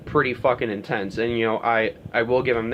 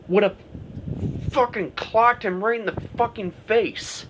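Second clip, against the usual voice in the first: ordinary delivery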